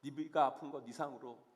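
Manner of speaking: low, soft, consoling voice; God's gentle comfort. Acted. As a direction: console the hurting softly, with pauses between phrases. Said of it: "그런 사무엘을 하나님은 위로하십니다".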